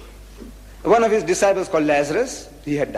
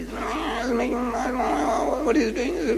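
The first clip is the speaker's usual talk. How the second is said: inaudible groaning prayer; 9 semitones higher, 4 dB quieter, much slower than usual. Acted. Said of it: "the words are not audible to the people".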